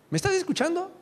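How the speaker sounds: high-pitched imitation of a wife; questioning wife. Wife to distracted husband, mock questioning. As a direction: mimic her checking he is paying attention.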